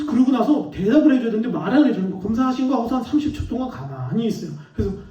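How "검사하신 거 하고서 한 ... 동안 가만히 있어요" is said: normal talking voice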